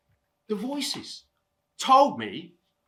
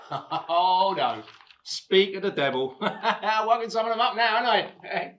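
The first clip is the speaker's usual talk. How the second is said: amused, laughing under the words. Acted. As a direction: say it through chuckles. Reacting to waking voices, laughs.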